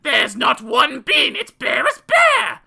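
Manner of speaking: high, loud shouting mother; a furious mum, slowing at the end; angry mother. A narrator's reading. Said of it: "The mother said".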